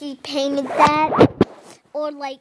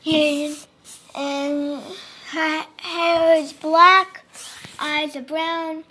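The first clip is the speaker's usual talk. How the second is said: usual speaking voice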